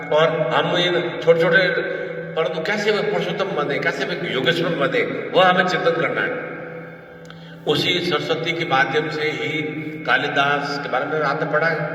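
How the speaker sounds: usual speaking voice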